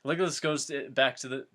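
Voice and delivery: ordinary talk, nothing marked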